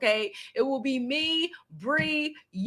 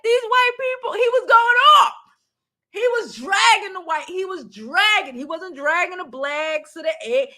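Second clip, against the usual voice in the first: animated retelling; 7 semitones higher, 3 dB louder than usual. Retold or imitated. Animatedly retells professor going off.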